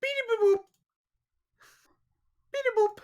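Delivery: very high squeaky beeps; Pokemon cry. Acted. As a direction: chirp it like a tiny robot.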